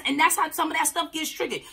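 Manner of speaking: usual speaking voice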